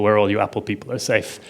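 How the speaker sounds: normal talking voice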